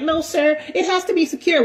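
high, prim gym-lady voice; mock the fussy Karen at the front desk. Gym Karen scolding a member about his bag, in a high prim voice. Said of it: "She like".

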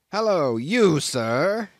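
cried out. A narrator's reading. Captain calling out to Queequeg, loud hail. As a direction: call him out sharply. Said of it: "cried the captain".

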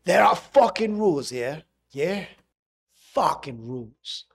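loud, aggressive London threat; shadowing a furious gangster; angry gangster. Retold or imitated. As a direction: hammer home the rules. Shadowing the angry movie line.

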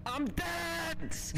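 high shocked squeal; shrieking. Real, not acted. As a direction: shriek in disbelief.

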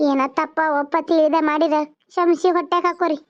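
ordinary talk

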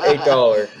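laughing through the quote. Retold or imitated. Retelling the stubborn Goodwill vendor's price, laughing. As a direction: quote the vendor while cracking up.

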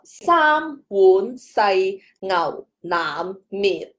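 三碗细牛腩面 read very slowly, all six tones in turn; teacher's slow recap of the six tones. Shown as a demonstration. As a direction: step down through 三碗细牛腩面 tone by tone. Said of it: "这个就是六调了".

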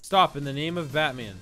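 usual speaking voice

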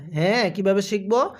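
usual speaking voice

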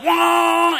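high, sustained engine whine; imitated engine roar. Rider recalls driver opening it up, imitated engine roar. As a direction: rev it like a four-wheeler at full throttle. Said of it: "So he opens it up".

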